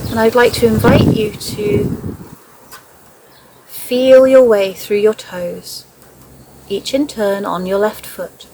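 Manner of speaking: measured meditation voice, leaving space after each phrase; guided meditation. Acted. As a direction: guide a listener gently into noticing the breath and body. Leads a guided body scan.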